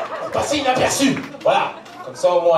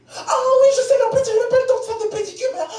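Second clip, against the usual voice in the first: put-on much higher, fast gushing girly voice; chatty girlfriend voice; 13 semitones higher than usual. He imitates the friend answering his shoe compliment, high girly chatter. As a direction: bubble over like a gossipy friend.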